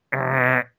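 vocal buzzer noise; imitated buzzer. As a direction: make the harsh noise of a reject buzzer. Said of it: "And then I hear".

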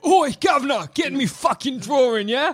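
high shouted Cockney; Cockney accent. Pretend. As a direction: yell it in broad Cockney. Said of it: "worst Cockney accent".

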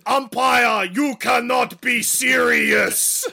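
slow, high monster shout; hammed-up beast tantrum at the umpire; outraged Bigfoot. Joins in as angry Bigfoot.

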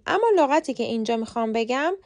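ordinary delivery

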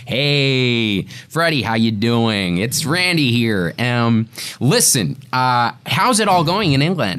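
put-on American accent, stretched greetings; American agent. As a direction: schmooze like an American talent agent. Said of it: "fantastic impression of your American agent".

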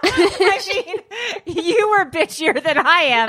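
high, laughing protest. Real, not acted. Teasing the friend about being bitchier, laughing. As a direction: tease her back while laughing.

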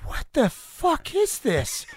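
high, slow, drawn-out stunned question; a kid floored by what he's seeing on stage; stunned disbelief. Retold or imitated. Retelling to a friend how [name] floored him, high and slow.

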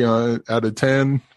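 ordinary talk, nothing marked

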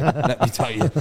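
said through a laugh. Real, not acted. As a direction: let the laugh spill over the words. Laughs while repeating the line.

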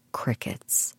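dry, whispered aside; a whispered deadpan beat. Real, not acted. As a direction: breathe the word out as a deadpan comment. Silence after her joke.

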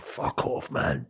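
hushed, breathy swear under the breath; whispered curse. Retold or imitated. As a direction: mutter it in a defeated whisper. Whispered despair at football.

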